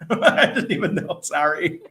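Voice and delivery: laughing apology, pitched high. Real, not acted. Apologising to Kitty for not knowing she's Australian, laughing.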